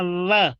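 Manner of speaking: व as a long buzzed V; V sound. Shown as a demonstration. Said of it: "निचला होंठ ऊपर पर दांत से मिला के". (व को दाँत-होंठ से V जैसा)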